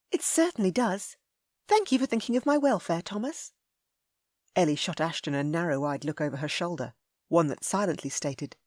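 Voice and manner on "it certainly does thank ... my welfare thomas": sweet to Thomas. A narrator's reading. Ellie sweet to Tidmore.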